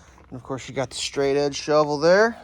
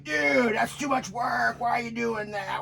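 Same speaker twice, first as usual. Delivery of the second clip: mocking a basement guy; 7 semitones higher, slower than usual.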